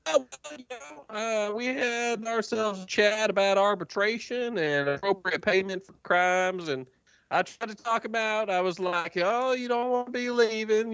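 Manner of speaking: high, folksy drawling prisoner; chatty, smug old codger; Jorge voice. Acted. Jorge explains the prisoners' departure, high and folksy.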